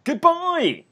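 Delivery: high, bright goodbye called out; big goodbye. Real, not acted. Podcast hosts calling out a big goodbye on three. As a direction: sing out goodbye to the listeners.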